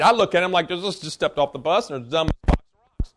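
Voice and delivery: low, scornful mutter; scornful aside. Real, not acted. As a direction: drop it low like a muttered put-down. Scornful aside about pressure.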